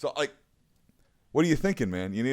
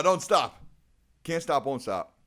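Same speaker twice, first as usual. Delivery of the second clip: normal talking voice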